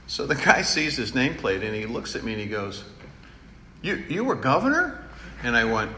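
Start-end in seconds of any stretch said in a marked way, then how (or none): 3.8-5.0 high, startled question; surprised repairman. Retold or imitated. Repair guy discovers the homeowner was governor, startled and high. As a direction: act amazed at the nameplate.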